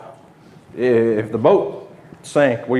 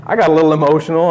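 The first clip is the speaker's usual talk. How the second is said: louder, faster retake; 4 semitones higher, 5 dB louder, faster than usual. Real, not acted. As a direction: say it again, louder and quicker. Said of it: "Did we lose the audio?".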